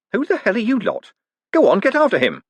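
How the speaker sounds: high sharp snap at the crowd; snapped. Acted. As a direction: snap at them to get moving.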